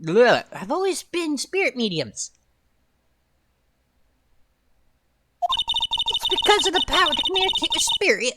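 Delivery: put-on high, thin old witch voice. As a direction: croak it out like a witch. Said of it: "I just made her voice a witch voice".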